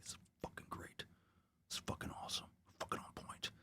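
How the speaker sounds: whispered mock admiration; awed whisper. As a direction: whisper it to yourself, savoring each phrase. Acting out private gleeful admiration.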